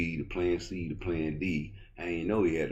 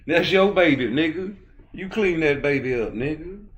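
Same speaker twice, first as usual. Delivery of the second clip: much higher and louder, yelling the blame on him; shouting; 10 semitones higher, 8 dB louder than usual. Real, not acted. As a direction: hit it at full yell. Shouted repeat of the same line.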